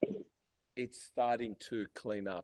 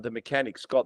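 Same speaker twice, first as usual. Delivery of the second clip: usual speaking voice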